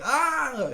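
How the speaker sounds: put-on short high wail of a startled baby; loud high mock cry. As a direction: mimic the baby bursting into tears. Imitating baby's cry.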